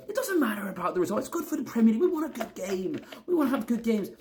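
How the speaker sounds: high-pitched mocking fan voice; hammed-up high mimicry of a clueless supporter; moronic fan. Fan imitating morons who only want good games, high and mocking. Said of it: "maybe the morons, you know, that think".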